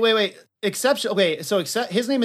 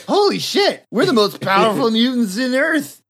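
high, excited exclaiming; thrilled and gloating, pitched up; mock amazement; 4 semitones higher than usual. Two mutants find they are most powerful, high mock excitement. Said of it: "and they're like".

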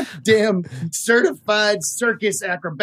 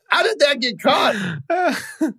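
laughing disbelief; 8 semitones higher, much slower than usual. Real, not acted. Incredulous shout-laugh.

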